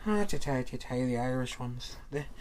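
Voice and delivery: put-on Irish brogue; mock Irish accent. Host joking about the Irish ones, mock Irish accent. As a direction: hold forth as a stage Irishman. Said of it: "doing some stuff with the Irish accent there".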